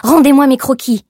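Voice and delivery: angry demand. A narrator's reading. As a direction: command it furiously, Rendez-moi hit hard. Demanding her sketches back.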